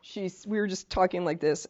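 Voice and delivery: ordinary talk, nothing marked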